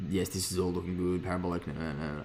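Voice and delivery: ordinary delivery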